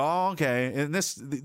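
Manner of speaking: usual speaking voice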